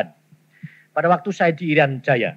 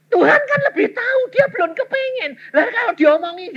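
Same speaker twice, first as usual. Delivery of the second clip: emotional preaching. Real, not acted. Preacher's climax, voice cracking.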